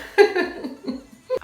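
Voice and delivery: bursting into a high laugh. Real, not acted. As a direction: laugh at own joke. Joking about Bella Hadid.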